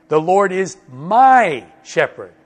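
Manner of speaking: my stretched long, high and loud; slow down and punch my; stress on my. Shown as a demonstration. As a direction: make my ring out above every other word. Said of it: "Not just the shepherd of other Christians".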